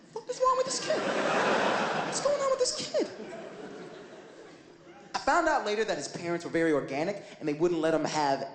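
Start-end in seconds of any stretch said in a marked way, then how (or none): 0.1-3.1 shocked disbelief. Retold or imitated. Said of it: "and I was like".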